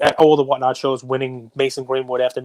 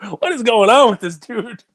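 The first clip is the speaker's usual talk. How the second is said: high, incredulous mock question; baffled disbelief; 7 semitones higher than usual. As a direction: act stunned by the guy. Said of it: "I was like".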